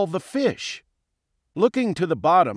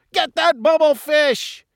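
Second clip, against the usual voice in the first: high, loud jeering voice; rowdy henchmen's high shout; gang shouting; 12 semitones higher, 5 dB louder than usual. A narrator's reading. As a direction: egg the boss on with a high yell. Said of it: "they shouted".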